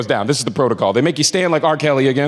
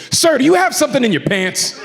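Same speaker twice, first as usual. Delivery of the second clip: high-pitched imitation of an officious agent; mock attitude; 7 semitones higher than usual. TSA agent confronts passenger at scanner, mock attitude. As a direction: challenge him, pitched up with attitude. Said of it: "comes at me with an attitude".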